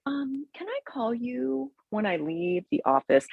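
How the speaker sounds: high, soft, guarded phone voice; crazy fake voice. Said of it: "I totally had that crazy sounding voice".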